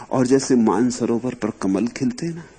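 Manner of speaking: ordinary talk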